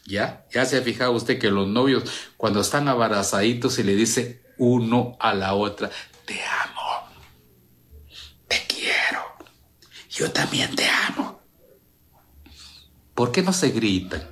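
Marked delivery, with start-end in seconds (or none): 6.2-11.4 breathy sweet nothings whispered close to the ear; tender unvoiced whisper; lovers' whisper. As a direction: whisper it like a lover in someone's arms. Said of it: "se hablan al oído".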